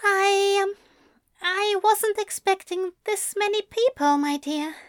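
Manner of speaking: high, nervous quivering voice. Acted. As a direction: let the voice quiver with nerves. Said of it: "his voice quivering slightly".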